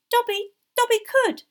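high, loud elf squeak; hesitant squeaky house-elf; doubtful elf. A narrator's reading. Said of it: "he said doubtfully".

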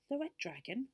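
high, uneasy question; frightened. A narrator's reading. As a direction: echo the name with mild fright. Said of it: "looking rather frightened".

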